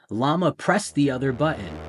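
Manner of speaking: ordinary delivery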